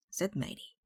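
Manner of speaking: usual speaking voice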